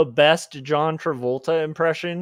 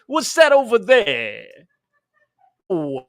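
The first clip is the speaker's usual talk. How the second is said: high, put-on dude voice with a laugh; a goofy guy voice, higher than his own; goofy real voice; 4 semitones higher, 4 dB louder than usual. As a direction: play a silly dopey guy. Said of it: "the real voice of me is that".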